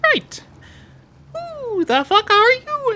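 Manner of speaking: very high, slow falsetto; super high voice. Acted. Streamer trying a super high voice for a character. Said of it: "a super high voice".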